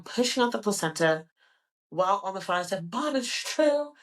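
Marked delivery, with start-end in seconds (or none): 2.9-3.9 high and urgent; urgent plea. Retold or imitated. To her mom on the phone, high and urgent.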